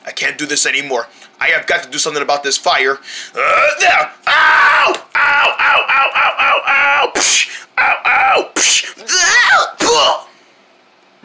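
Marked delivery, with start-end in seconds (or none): 4.3-11.2 pained yelps. Pretend. Burned by the fire.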